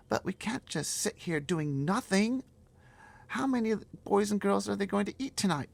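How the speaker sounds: put-on a small girl pleading to stop the giants; high girl's voice. Girl begging the giant, high and loud.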